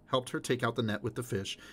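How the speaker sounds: usual speaking voice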